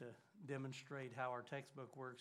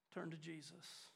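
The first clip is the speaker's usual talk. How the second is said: usual speaking voice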